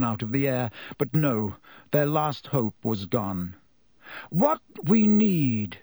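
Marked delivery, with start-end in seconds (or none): 4.3-5.8 slow, weighty Dumbledore; slow and grave; slowly. A narrator's reading. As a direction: draw the words out, grave. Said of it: "said Dumbledore slowly".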